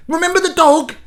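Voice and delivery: high, loud smug reminder; mocking know-it-all. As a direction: smugly remind him of the fable. Imitated friend citing fable.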